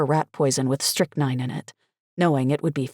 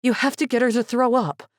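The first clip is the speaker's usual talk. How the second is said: panting; 7 semitones higher than usual. A narrator's reading. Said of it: "I panted".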